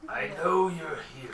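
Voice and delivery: lightly voiced orc taunt; a hint of monster menace. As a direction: call out to hidden prey as an orc. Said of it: "you hear".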